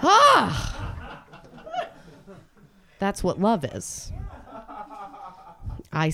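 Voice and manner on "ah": hammed-up scandalized gasp; mock shocked gasp. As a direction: gasp as if clutching your pearls. Demonstrates clutching pearls.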